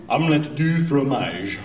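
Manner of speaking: slow careful mock French; practiced French. Shown as a demonstration. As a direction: carefully pronounce the phrase. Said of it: "I'm practicing all the time".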